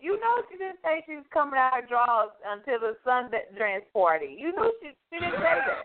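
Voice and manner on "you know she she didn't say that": much higher squeaky protest; mock-outraged high voice; mock high defence.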